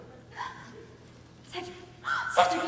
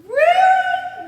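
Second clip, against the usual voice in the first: surprised; 10 semitones higher, 10 dB louder than usual. Acted. Feigned interest.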